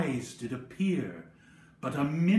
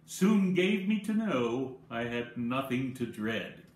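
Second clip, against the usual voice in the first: plain reading voice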